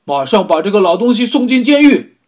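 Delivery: furious high shout. Acted. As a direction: scream the order in rage. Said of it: "他喊道".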